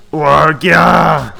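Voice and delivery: loud guttural mock roar; big cartoon attack yell; battle cry. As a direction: roar out a nonsense monster cry. Voicing a battle cry in game.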